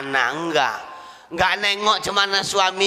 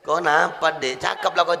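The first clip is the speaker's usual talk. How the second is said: usual speaking voice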